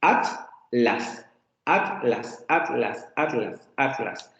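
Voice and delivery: "Atlas" repeated as at-las, t closing the first syllable, the gap narrowing; separado at-las. Shown as a demonstration. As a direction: break "Atlas" after the t, slowly at first, then closer together. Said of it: "hacer de otra manera". ("Atlas" repetido como at-las, acortando la pausa)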